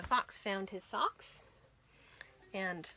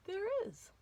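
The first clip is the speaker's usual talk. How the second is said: surprised gasp; 11 semitones higher, 6 dB quieter than usual. Acted. Finds the hat.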